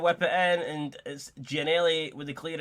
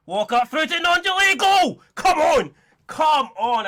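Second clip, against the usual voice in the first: screaming goal call, far higher and louder; goal celebration shout; 13 semitones higher, 11 dB louder, slower than usual. Real, not acted. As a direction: roar the goal at full voice. Equaliser scored.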